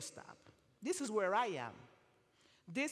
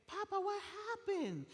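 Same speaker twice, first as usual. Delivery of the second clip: tender concern; 11 semitones higher than usual. Retold or imitated. Comforting a crying man at bus stop.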